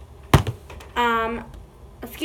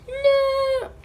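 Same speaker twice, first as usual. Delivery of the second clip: mock cry; 12 semitones higher, 4 dB louder than usual. Pretend sister slime dying.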